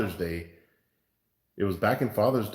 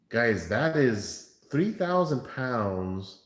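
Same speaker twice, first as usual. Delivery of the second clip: "three thousand pounds" drawn out slowly; slow emphasis; much slower than usual. Real, not acted. Stressing the smoker's weight.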